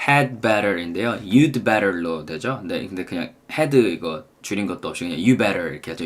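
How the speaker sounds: ordinary talk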